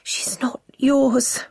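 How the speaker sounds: breathless, slow whisper. Acted. Wife denying to her husband, frightened whisper. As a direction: whisper it in fear. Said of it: "Coco whispers".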